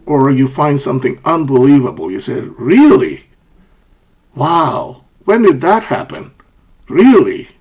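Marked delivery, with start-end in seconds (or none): 2.4-7.5 "Really" jumping high, then swooping down; acted disbelief, high-pitched questions; surprised. Shown as a demonstration. Teacher acts out hearing something unbelievable, surprised. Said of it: "when you're surprised".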